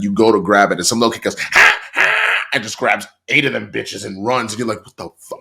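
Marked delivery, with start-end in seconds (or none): 1.4-2.1 put-on high breathy taunting kid giggle; mock kid taunt laugh. Retelling a kid stealing samples, high taunting laugh. 4.9-5.4 stunned whisper. Retold or imitated. Stunned reaction to kid.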